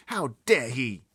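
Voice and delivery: high and loud, outraged; indignant girl. Acted. Sophie outraged at the giant's plan, high loud. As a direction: spit it out in outrage.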